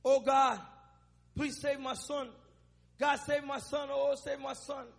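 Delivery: ordinary delivery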